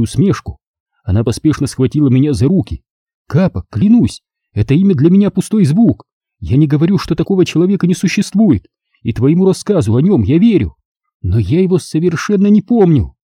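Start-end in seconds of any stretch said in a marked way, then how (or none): 3.3-13.1 put-on earnest older woman, higher pitch; tragic heroine sorrow. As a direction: plead sincerely, swearing it's true. Leonora's voice, pleading.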